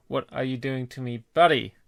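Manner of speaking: usual speaking voice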